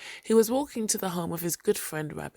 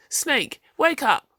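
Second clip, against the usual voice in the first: high, loud wake-up shout; 8 semitones higher, 5 dB louder, slower than usual. Acted. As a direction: shout him awake. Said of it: "Rabbit shouted".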